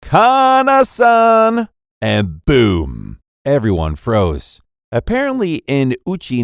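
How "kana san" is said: loud, high, long-drawn name; confident call-out. A narrator's reading. Boy calling her first name at the beach, loud confident call. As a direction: sing out the name boldly and slowly. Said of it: "he confidently called out".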